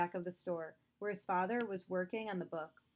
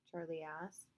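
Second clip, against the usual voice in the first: ordinary delivery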